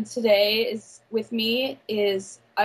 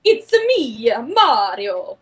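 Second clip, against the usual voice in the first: put-on Italian accent Mario; 5 dB louder, much slower than usual. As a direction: do a cartoon Italian plumber accent. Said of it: "Mario the best in the Italian accent".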